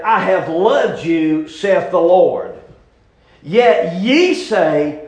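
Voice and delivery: slow, emphatic pulpit reading; scripture reading. Acted. Preacher reading Malachi to his church, slow and declamatory. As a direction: draw the verse out solemnly for the congregation.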